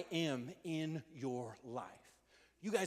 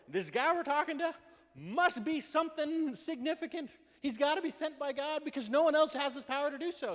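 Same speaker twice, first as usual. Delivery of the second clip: much higher and louder, slowing on significant; fervent proclaiming; 11 semitones higher, 6 dB louder than usual. Acted. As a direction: build to a triumphant preaching peak. Preacher voicing the man's argument.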